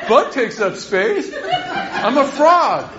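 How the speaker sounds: slow, high, laughing through the words; slow words broken by laughter. Real, not acted. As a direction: giggle it out, amused at yourself. Laughing at his own thought.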